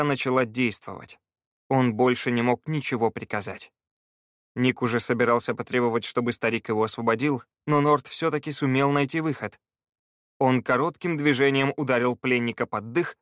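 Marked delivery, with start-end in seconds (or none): none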